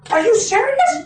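high, disbelieving question; can't believe he's never ridden; surprised. Retold or imitated. Guide can't believe the story.

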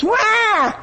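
high, hammed-up toddler cry; mock baby wail. Preacher mimics a spoiled child crying, high mock wail.

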